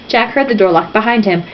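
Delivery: usual speaking voice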